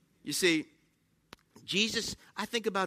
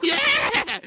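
mock cheer; 12 semitones higher, 4 dB louder than usual. Car hyped up on bad fuel.

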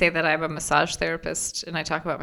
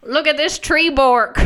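loud, high, slow Boston "bork"; hammed-up Boston, "bark" as "bork"; mock Boston accent; 9 semitones higher, 7 dB louder, slower than usual. Host mocks co-host's tree bark, exaggerated Boston accent. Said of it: "how they would say it in Boston".

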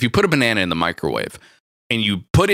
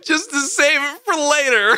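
laughing. Real, not acted. Joking through laughter.

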